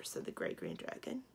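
plain reading voice

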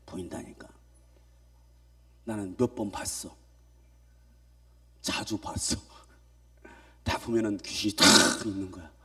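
속삭이듯 낮게 털어놓기; 비밀 얘기하듯 숨소리로; whisper. Real, not acted. Preacher confides seeing ghosts. (속삭이며 귀신 본 얘기)

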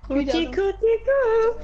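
high, slow call like to a pet; high coaxing call. Pretend. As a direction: coax him in with cookies.

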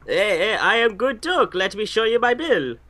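high-pitched Eastern European pickup artist; Eastern European accent. Acted. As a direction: ham up a cheesy come-on in an Eastern European accent. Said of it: "acted out by all Eastern European pickup artists".

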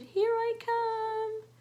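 high, drawn-out tiny-creature call; little ladybug voice. Acted. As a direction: pipe up slowly as a little ladybug. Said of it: "As a little ladybug said".

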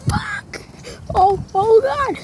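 high startled gasps; startled exclaiming. Real, not acted. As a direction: caught off guard on the swing. Startled on spinning swing.

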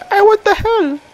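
squeaky falsetto indignation; high mock voice. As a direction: pipe it up like a cartoon. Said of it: "He's like".

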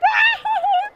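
very high-pitched whooping laugh; gleeful mock laughter. Crowd laughing at the giant's defeat, gleeful. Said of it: "They start laughing".

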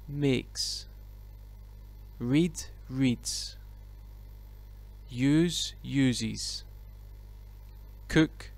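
each verb said clearly, then with its s ending; model pronunciation drill. Shown as a demonstration. Teacher models verb pairs for the class to repeat, clearly. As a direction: demonstrate each pair for students to repeat. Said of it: "Repeat after me".

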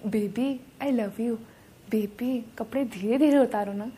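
low, soft lover's coo; put-on romantic murmur, pitched well down; mock sweet talk. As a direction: imitate a smooth boyfriend, hushed and tender. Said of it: "हजार प्यार की बातें".

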